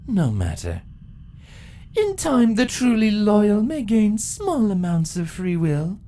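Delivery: proud empress telling her victory; ancient empress. Acted. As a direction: gloat over the long wait. Empress decree.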